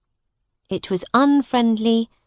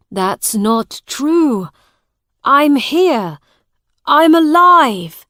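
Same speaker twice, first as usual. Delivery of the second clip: rising, louder, pleading that he lives; desperate cry, higher and louder by the end; desperate insistence; 6 semitones higher, 8 dB louder, slower than usual. Acted. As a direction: push harder and higher with each line, begging to be believed.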